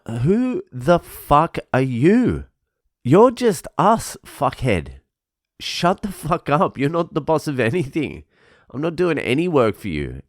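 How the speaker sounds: high, incredulous retort to a bossy coworker; worked-up, high-pitched comeback; indignant telling-off. Retold or imitated. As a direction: act out putting someone in their place, pitched well up.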